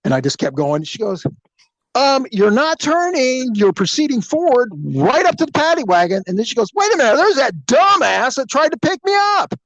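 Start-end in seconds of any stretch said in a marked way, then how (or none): 2.0-6.2 insistent, high-pitched complaint; exasperated protest. Retold or imitated. Passenger protesting again, retold. 6.7-9.5 high, loud indignant outburst; loud, high-pitched disbelief; outraged recognition. Retold or imitated. Passenger recognizes officer, retold.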